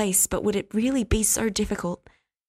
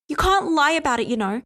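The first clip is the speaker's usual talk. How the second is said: higher, louder emotional outburst; cried out; 7 semitones higher, 4 dB louder than usual. Acted. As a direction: cry it out at him, flushed with emotion. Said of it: "she cried".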